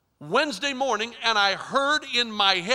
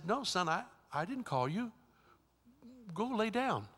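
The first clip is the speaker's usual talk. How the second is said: Eli answering quietly, a hint of sleepy; hushed, gentle reply to the boy; softly voiced old priest; 12 dB quieter than usual.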